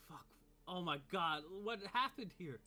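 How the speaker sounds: a goon finding a grisly scene; alarmed cry of dismay; shocked cultist. Acted.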